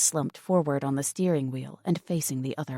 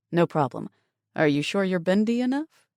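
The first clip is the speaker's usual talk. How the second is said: teasing with a smile; 3 semitones higher, 4 dB louder than usual. A narrator's reading. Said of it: "he asked with a smile".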